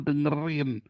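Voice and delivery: ordinary talk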